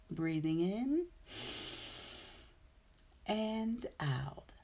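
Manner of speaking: guided meditation. Acted. Breathing cue in meditation.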